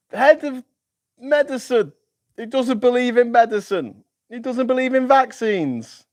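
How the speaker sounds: exasperated mock-outrage at absurd appointments; incredulous rant. Real, not acted. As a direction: squeal it out as if you can't believe it. Incredulous rant about Trump appointees.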